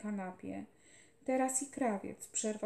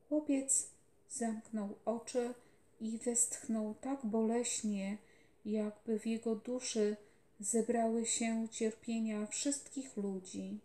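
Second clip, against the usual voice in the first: usual speaking voice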